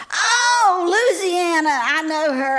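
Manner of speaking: loud, high burst of sudden remembering; light up as it comes back to you; excited recognition. Acted. Old teacher suddenly remembers her pupil, excited.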